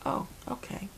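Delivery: high, whispered oh okay; awkward whisper. Retold or imitated. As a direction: breathe it out, taken aback. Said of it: "I was like".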